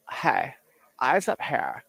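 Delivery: light mock of the ad's flirty line; mocking her voice.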